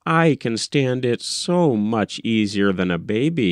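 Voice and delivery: usual speaking voice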